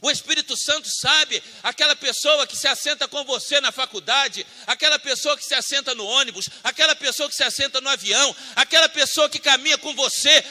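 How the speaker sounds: ordinary talk, nothing marked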